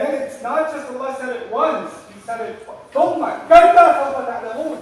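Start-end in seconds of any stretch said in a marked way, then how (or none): 2.9-4.8 loud, fast stern "ثم كلا سوف تعلمون" with a rising end; an angry scolding recitation; forceful warning. Shown as a demonstration. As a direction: hurl it as a scolding threat. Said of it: "the tafsir of the ayah is in the way you're gonna say it".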